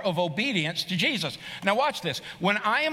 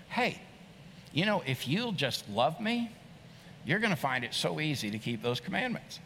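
gentle invitation; 4 semitones lower, 6 dB quieter than usual. Shown as a demonstration. Preacher showing Jesus inviting love gently, soft and tender. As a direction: coax it kindly like a loving friend. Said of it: "but it sounded like this".

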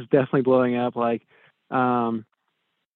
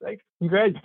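dry mock thanks, higher; fake-cheerful sarcastic congrats; 6 semitones higher than usual. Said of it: "I'm like".

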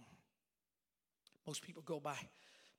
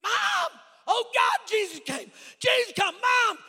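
panicked, high full-force scream; acted terror, hollering at the top of the voice; freaking out; 20 dB louder, slower than usual. Boy believes he is left behind, panicked screaming. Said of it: "I mean, I am freaking out".

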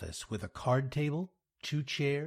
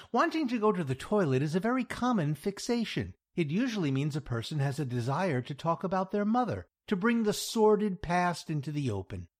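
fast, higher-pitched mock-clinical patter; urgent bluffing; 7 semitones higher, 3 dB louder, faster than usual. Jack steering Louise from toilet to mother, urgent bluffing. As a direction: rattle off the diagnosis under pressure. Bluffing client away from bathroom.

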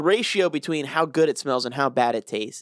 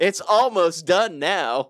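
slow, higher-pitched teasing temptation; mock taunting; 4 semitones higher, much slower than usual. Chips tempting him to finish the bag, mock taunt. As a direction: draw it out like a tempting little taunt. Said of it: "They're taunting you".

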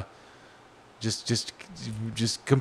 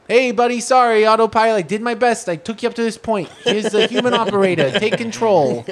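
higher, chummy Brooklyn guy as the autopilot; a friendly Brooklyn buddy handing over the wheel; 8 semitones higher, 3 dB louder than usual. Acted. Autopilot handing control to the driver, in a Brooklyn guy voice. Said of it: "like, a Brooklyn guy voice?".

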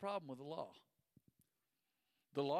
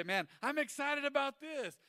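mock excited kid; 11 semitones higher, 6 dB louder than usual. Voicing an overexcited kid.

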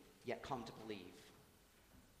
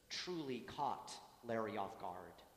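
ordinary talk, nothing marked

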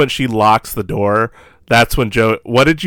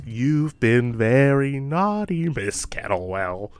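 slow, much softer taunting doll; creepy doll voice; 6 dB quieter, slower than usual. Retold or imitated. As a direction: draw it out softly, savouring the threat. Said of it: "Because Chucky goes".

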